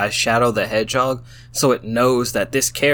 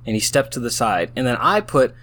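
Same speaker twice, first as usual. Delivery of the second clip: ordinary delivery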